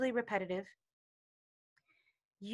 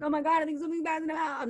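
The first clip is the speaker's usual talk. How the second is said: fast, much higher, panicky rush; freaking out; 6 semitones higher, much faster than usual. Retold or imitated. Imitating a caller freaking out about bad news, fast high panic. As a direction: rattle it off in a frightened hurry. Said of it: "called me freaking out".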